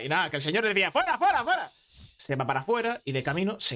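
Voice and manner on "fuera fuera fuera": high, insistent mock shooing; high-pitched repeated command; angry dismissal. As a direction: order someone out as an irate boss. Acted boss throwing employee out.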